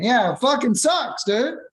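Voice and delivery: high, mocking buddy-buddy tone; jokingly tactless caller; mock chummy caller.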